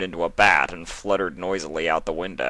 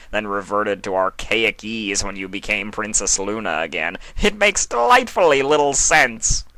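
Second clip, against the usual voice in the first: Discord; 3 semitones higher, 4 dB louder than usual. Acted. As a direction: toss it off with gleeful chaos. Discord voiced.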